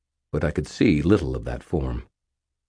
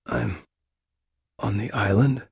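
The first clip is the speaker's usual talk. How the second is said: raspy; 5 semitones higher than usual. A narrator's reading. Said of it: "I rasped".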